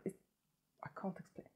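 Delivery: soft whisper of wonder; awed whisper. Real, not acted. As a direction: breathe it, lost for words. Awed by lip shine.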